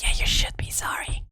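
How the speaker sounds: voicing her pet in a hushed, breathy scold; a scolding whisper from a pet; whispered role voice. Playing Whisper scolding him, in a whisper. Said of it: "And she's like".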